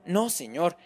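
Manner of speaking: a mother near tears, defending herself; tearful mother. Acted. Mother explaining to the detective, high and close to tears. Olga describing the man.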